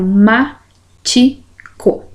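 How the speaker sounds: "Matico" very slowly as ma, ti, co; sílaba por sílaba. Shown as a demonstration. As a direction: separate "Matico" with a pause after each syllable. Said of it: "na sequência eu separo". ("Matico" bem devagar, sílaba por sílaba)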